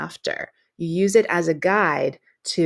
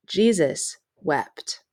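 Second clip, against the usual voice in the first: slow, solemn reading with a pause after "wept"; weighty pause; slower than usual. Shown as a demonstration. Teacher reading the Bible verse to students, slow and solemn. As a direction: let "Jesus wept" land slowly and hold the pause after "wept". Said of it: "it creates kind of a pause after the word wept".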